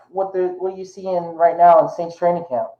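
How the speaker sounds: usual speaking voice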